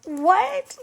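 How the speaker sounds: baffled what. Retold or imitated. Mock baffled reply to her husband. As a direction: squeak it out in disbelief.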